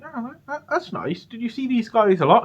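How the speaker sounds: a mum trying to stay polite; imitating puzzled mum. Mum seeing the fursuit photos, high and puzzled.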